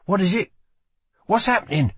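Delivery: high, urgent worried questions; alarmed. A narrator's reading. Lila alarmed as he collapses.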